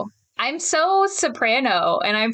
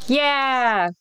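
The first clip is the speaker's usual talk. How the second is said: practice yell; 3 semitones higher than usual. Shown as a demonstration. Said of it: "Go for it".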